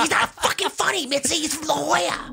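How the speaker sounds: very high mock yelling; imitated Rogan. Rogan complaining to Mitzi about the comic, high strained mock yell. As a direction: squeal it like a hammed-up heckler.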